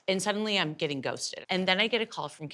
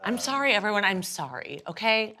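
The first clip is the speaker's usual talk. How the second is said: public apology; 5 semitones higher than usual. Pretend. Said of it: "Here's my public apology".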